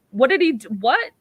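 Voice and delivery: ask, totally lost; confused. Retold or imitated. Fraser baffled by the unseen rudeness, high and loud. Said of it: "Fraser's like".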